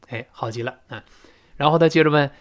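ordinary talk, nothing marked

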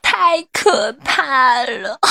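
slow, theatrical wail; hammed-up crying. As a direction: draw it out into a mock sob. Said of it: "不哭了".

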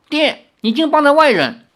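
high, loud outraged cry; angry. Acted. Daughter angrily reproaching her father, high and loud. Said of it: "林月如怒道".

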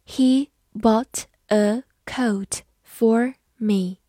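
very slow, each word set apart; slow language-teacher model with clear gaps. Shown as a demonstration. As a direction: dictate it one word at a time.

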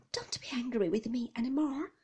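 unvoiced, pleading whisper to her husband. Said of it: "I whispered".

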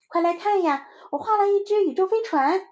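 put-on high, loud child's voice; excited little child. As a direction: call a friend over, proud and high. (兴奋的小孩高声炫耀)

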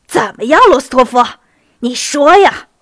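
loud, challenging shout. A narrator's reading. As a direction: shout the demand at him. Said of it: "杰尼索夫跳起来喊道".

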